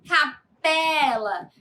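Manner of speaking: "Capela" drawn out slowly, PE strongest; stress on PE. Shown as a demonstration. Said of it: "a entonação é mais forte". ("Capela" bem devagar, com força no PE)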